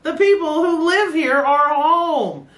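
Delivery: high, drawn-out alarm; a friend shouting that the owners are back; mock panicked yell. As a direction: sound the alarm like a caught partygoer. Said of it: "when he yells".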